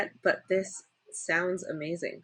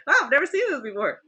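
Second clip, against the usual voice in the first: higher, quick retold amazement; amazed; 8 semitones higher, 5 dB louder, much faster than usual. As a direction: relive the wonder in a high, rushed voice. Retold amazement, ends in laughter.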